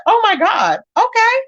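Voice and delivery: rising high in mock surprise; surprised oh my god. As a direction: squeal it out, pitch climbing high on okay. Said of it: "I said".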